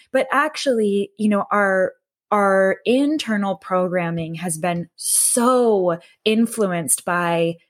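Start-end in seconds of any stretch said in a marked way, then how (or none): none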